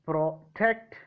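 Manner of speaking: slow pro-TECT, weight on the end; stress on second syllable. Shown as a demonstration. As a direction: separate "pro", then hit TECT in protect. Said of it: "second syllable of the first letter".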